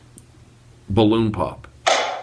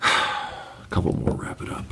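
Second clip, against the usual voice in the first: whispered sigh; 10 semitones lower, 9 dB quieter than usual. Real, not acted. Sighing, unvoiced aside.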